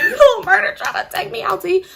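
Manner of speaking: high, loud squeal of delight; can't believe he asked; thrilled disbelief. Retold or imitated. Simone hearing her crush ask her out, thrilled.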